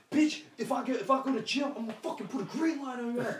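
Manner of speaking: high fast put-on thug voice; threatening gangster.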